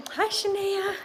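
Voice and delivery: high, sing-song pet greeting; cooing at the cat. Real, not acted. Greeting her cat in a high coo. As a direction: lift the voice way up for a pet.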